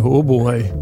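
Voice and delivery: ordinary talk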